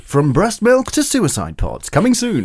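slow, dramatic trailer announcer; movie trailer voice. Pretend. Guest mocks a film trailer announcer, slow and dramatic. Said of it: "life in podcasts with Sarah Damagy".

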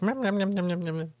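high cat chomping noises; nom nom chomping. Retold or imitated. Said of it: "my cat made the nom nom nom sound".

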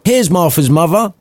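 put-on high little girl voice; lightly voiced young daughter. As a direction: daughter spotting her sister at the window. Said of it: "said one of the girls".

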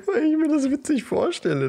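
laughing. Real, not acted. Lacht beim Erklären.

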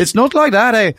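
badly faked Irish accent, pitched high; Scottish-sounding Irish accent. Shown as a demonstration. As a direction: overshoot Irish and land in Scottish. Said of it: "Yours is more Scottish".

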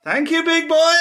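a high, loud thank-you to the dog; pet voice. Real, not acted. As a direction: gush at the dog in a squeaky high voice. Praising the dog.

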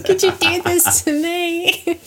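high, slow hammed-up whine; mock wounded plea. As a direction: plead slowly like a betrayed child. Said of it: "I'm like".

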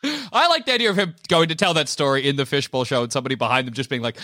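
ordinary delivery

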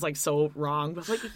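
ordinary talk

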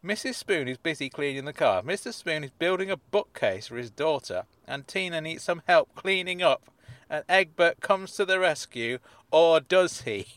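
hammed-up dramatic read, rising to the end; cheesy cliffhanger narration; mock suspense. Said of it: "attempted to introduce some kind of element of suspense".

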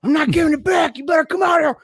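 very high imitated yelling; taunting shout. Man trying to lure us back, taunting shout. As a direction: holler a threat to draw them out. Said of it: "he's trying to use that to lure us back".

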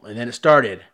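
ordinary talk

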